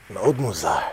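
grave mock-doctor verdict; baffled specialist. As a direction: drop low, like a puzzled heart doctor. Plays the baffled specialist again.

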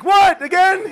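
high, loud startled shout; exasperated mock shock, voice shooting up; startled disbelief. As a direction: yelp it as if jolted awake. Woken by the alarm again.

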